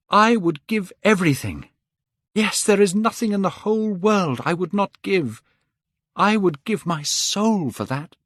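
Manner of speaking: high, loud, passionate pledge; fervent vow. A narrator's reading. Dorian vows his soul for eternal youth, high and loud. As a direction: swear it with everything.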